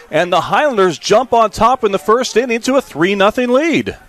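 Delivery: full-throated play-by-play eruption; excited home-run call. Real, not acted. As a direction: climb with every run that scores. Announcer calls a three-run double.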